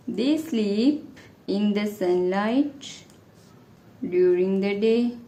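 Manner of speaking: very slow, drawn-out dictation; dictating. Real, not acted. Teacher answering the tiger question for students, dictating very slowly. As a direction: stretch each word out for writers to follow.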